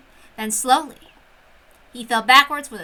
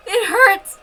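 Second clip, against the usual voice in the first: pained cry; 8 semitones higher, 7 dB louder than usual. Acted. Beatrice stomped on, crying.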